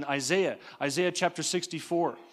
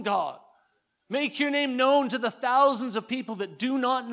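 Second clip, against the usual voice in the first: impassioned prayer; 4 semitones higher than usual. Acted. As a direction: beg God to come down. Prays Isaiah fervently.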